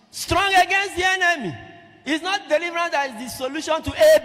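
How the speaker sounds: loud, high-pitched proclamation; impassioned pulpit shout, sustained high; full-force preaching. Real, not acted. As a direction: drive the point home to the congregation.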